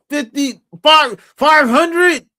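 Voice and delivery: slow, high disbelieving question; incredulous. Real, not acted. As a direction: stretch it out in disbelief. Incredulous at low subscriber count.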